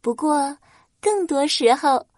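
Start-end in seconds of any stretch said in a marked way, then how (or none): none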